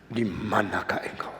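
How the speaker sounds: a quiet, heavy question; low soft question. Shown as a demonstration. Preacher tries the line low and soft.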